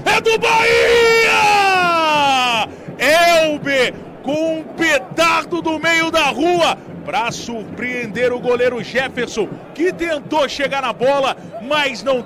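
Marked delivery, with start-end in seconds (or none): 0.1-6.8 long, stretched, high goal shout; explosive goal commentary. Real, not acted. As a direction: hold the vowels, celebrate loudly. Goal celebration call.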